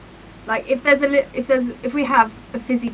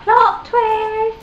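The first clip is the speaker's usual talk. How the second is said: high, loud dramatic cry; excited exclamation; 10 semitones higher, 5 dB louder than usual. Retold or imitated. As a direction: throw it out like a big TV reveal. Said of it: "I was like".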